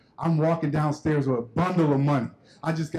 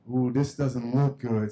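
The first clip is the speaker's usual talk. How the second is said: uneasy; 7 semitones lower, slower than usual. Retold or imitated. Boy sees dad holding money.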